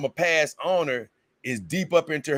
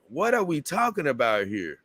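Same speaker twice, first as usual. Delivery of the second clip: ordinary delivery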